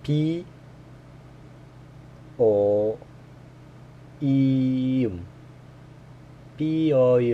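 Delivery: P O E M called out letter by letter, loud and high; spelling letter by letter. Shown as a demonstration. Learner spelling out a Korean syllable, loud letter by letter. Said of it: "Pronounced together would be".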